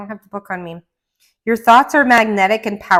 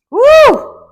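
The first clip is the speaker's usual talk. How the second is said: loud, high whoop of joy; excited whoop; 12 dB louder than usual. Real, not acted. Reader whooping at a tarot card drawn. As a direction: let out a thrilled whoop.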